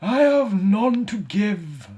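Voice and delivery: high, slow lament; mournful ghost. Acted. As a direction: grieve for the wasted life. Ghost refusing comfort.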